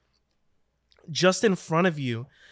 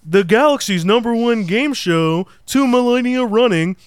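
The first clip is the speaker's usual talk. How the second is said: game show announcer; 6 semitones higher, 8 dB louder than usual. Acted. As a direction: announce the host like a big TV show. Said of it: "that ethereal voice returns".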